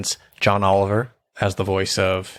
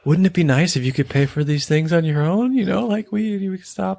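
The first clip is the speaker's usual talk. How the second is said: higher, kind coaxing voice; gentle Bob; 9 semitones higher than usual. Retold or imitated. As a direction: Bob gently coaxing Gail about money, kind and high. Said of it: "it's more like".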